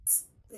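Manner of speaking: a voiceless puff of t; just breathe out a crisp t; whispered t sound. Shown as a demonstration. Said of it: "is pronounced as t".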